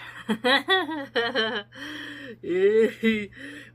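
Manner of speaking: loud, high burst of real laughter; helpless laughing fit; big laugh. Real, not acted. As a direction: crack up at the line. Amused by the slippery witch line.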